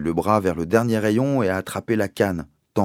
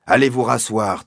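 barked order, louder and higher; 4 semitones higher, 6 dB louder than usual. A narrator's reading. Captain ordering the caned boys back, barked and loud. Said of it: "a ordonné le capitaine Lancaster".

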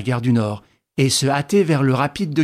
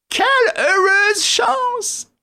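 high-pitched squeaky companion; thin high character voice; Wilson, injured sidekick; much slower than usual. Acted. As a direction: pipe up in a high, put-on voice.

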